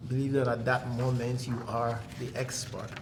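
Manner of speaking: ordinary talk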